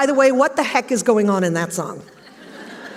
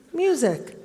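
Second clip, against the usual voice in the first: retold puzzled reaction; 3 semitones higher, 3 dB quieter than usual. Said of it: "they looked at me like".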